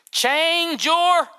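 mocking stern religion; mock preachy command. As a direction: sneer it as a religious order.

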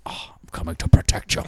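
whispered, fast menacing threat; Liam Neeson whisper. Pretend. Liam Neeson parody threat in a quick whisper. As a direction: breathe a hushed promise.

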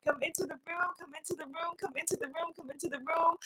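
softly calling everyone in; soft invitation. Acted. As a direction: beckon them in gently.

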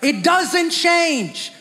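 It doesn't change, drawn out slowly and called out strongly; emphatic preaching. Acted. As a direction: hammer each word of It doesn't change slowly. Preacher stressing the point.

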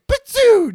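high, loud zap sound effect; cartoon zap, squeaky and punchy; laser zap noise. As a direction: fire off a laser blast with your mouth.

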